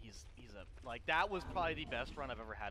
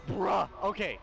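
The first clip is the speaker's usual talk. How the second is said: panicked yelling; 12 semitones higher than usual. Real, not acted. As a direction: gasp and yell in panic. Near death panic.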